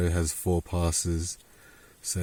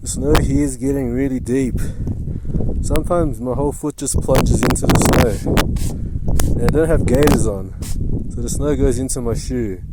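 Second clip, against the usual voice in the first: raised voice while wading through snow; loud and effortful; 3 semitones higher, 10 dB louder than usual. Real, not acted. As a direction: push the words out over the effort. Trudging through deep snow.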